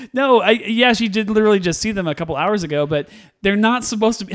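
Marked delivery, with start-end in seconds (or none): none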